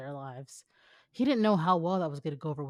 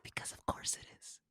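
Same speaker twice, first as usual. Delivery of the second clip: dry whispered aside; whispered sarcastic aside; 10 dB quieter than usual. Acted. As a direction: hush it out knowingly. Whispered wry answer.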